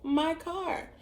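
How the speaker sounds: high, drawn-out disbelief; incredulous. Retold or imitated. Girlfriend to boyfriend who punched her car, incredulous. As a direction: stretch the words out in retold shock.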